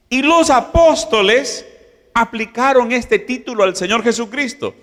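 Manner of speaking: high, fervent preaching voice; preaching at full force. Acted. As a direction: build the message with rising fervor. Preaching climax on Kyrios.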